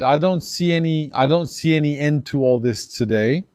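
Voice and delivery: ordinary delivery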